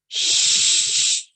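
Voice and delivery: whispered shush. A narrator's reading. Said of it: "Frog didn't make a sound".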